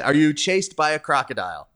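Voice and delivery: ordinary talk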